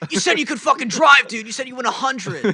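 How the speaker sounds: high, loud furious yell; mock furious yelling at the kid driver. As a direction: scream at him for lying about driving. Yelling at the thirteen-year-old driver.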